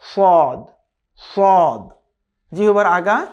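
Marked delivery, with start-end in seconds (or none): 0.0-1.9 সদ with a heavy, emphatic s; emphatic Arabic সদ; Arabic sad. Shown as a demonstration. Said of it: "ও আগার সাথে লাগিয়ে".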